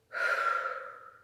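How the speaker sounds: audible breath out through the mouth; a cleansing exhale shown to listeners; demo exhale. Shown as a demonstration. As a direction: let the breath go with a sigh. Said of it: "then on the exhale".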